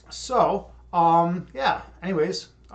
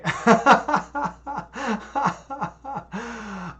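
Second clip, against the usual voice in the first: real laughter; 6 semitones higher, 3 dB louder than usual. Real, not acted. Laughing at own mispronunciation.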